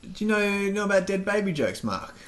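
lightly voiced coworker, amused at the end; retold colleague's question. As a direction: act out a workmate posing a question. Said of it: "they were just going there like".

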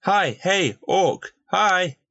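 high, bright call of hello; cheerful greeting. Acted. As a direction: wave and call out happily. Golem cheerfully greeting the orc.

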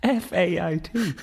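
spelling it out through high giggles; laughing. Real, not acted. Host spells Fiat while still laughing, very high.

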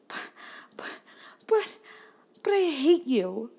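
put-on stammered, high, loud kid outburst; sputter, then burst out in a child voice; sputtering upset daughter. Four-year-old out of excuses to Mom, upset sputtering. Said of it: "clearly struggling to find some kind of excuse".

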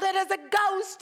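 high, loud cry of "It is a ghost!"; a disciple shrieking at the sight of a ghost; terrified yell. Acted. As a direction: yell it out in terror. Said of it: "and they yelled".

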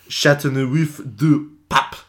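slow, high hammed-up French accent. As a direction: say it like a proud Frenchman. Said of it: "Your French accent".

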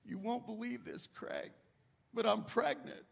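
quiet, tearful retelling of his wife's news; a soft, choked hint of tears; wife breaking the news through tears, lightly voiced; wife crying. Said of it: "my wife came in crying".

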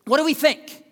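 loud and high; full-force preaching. Acted. Pastor challenging the church, preaching hard. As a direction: press the question on them.

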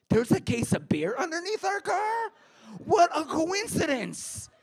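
fake surprise. Teen in a panic playing dumb to the police officer, squealing. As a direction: play dumb with panicked high innocence. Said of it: "I was in a panic".

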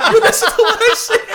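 high, loud mock shout of an old father. Son mimics dad yelling at sister, high mock shout. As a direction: bellow it like an offended dad. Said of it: "She goes".

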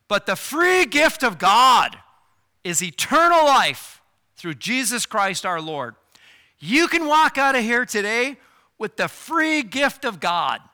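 full-force preaching. Acted. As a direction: announce the good news boldly. Preaching the free gift.

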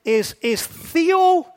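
slow, careful Greek pronunciation of "theopne-"; Greek word spoken slowly. Shown as a demonstration. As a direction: draw out "theopne-" syllable by syllable. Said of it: "the Greek word is".

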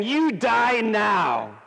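slow, high, shouted death sentence; Grim Reaper. Acted. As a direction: shout it slowly as menacing Death. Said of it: "the Grim Reaper appearing to Jesus".

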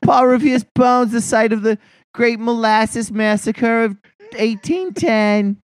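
high, drawn-out mock announcement; mocking tourist pitch. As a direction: stretch it out high, poking fun. Mocking Boston's tourist sights.

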